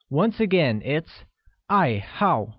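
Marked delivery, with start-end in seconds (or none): 1.5-2.5 爱好 with each syllable dropping in pitch; falling tones. Shown as a demonstration. Teacher repeats the word for hobby, both syllables of 爱好 in falling tone. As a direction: let both syllables of 爱好 fall. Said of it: "pronounced with the falling tone on both A's".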